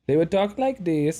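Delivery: sing-song put-on Swedish accent; mock Swedish accent. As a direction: imitate a Swede speaking English. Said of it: "that's pretty Swedish".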